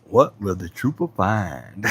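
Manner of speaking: mock TV narrator building suspense; a hint of the show's narrator, drawn out; imitating the narrator. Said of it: "I love when he says that".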